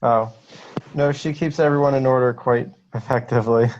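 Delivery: ordinary talk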